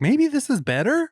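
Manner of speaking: high, musing voice; mock thoughtful. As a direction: muse it like a kid deciding. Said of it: "I was like".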